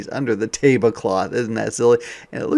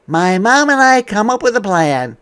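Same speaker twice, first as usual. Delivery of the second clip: higher and louder, building trouble; dramatic storytime; 11 semitones higher, 11 dB louder than usual. Acted. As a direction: read it like the plot thickens. Reading picture book aloud.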